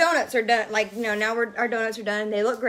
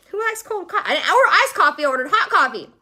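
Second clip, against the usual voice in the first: high, exasperated complaint; fed up with cold coffee, voice climbing; indignant; 6 semitones higher, 3 dB louder, faster than usual. Real, not acted. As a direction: let the outrage spill out. Indignant rant about cold coffee.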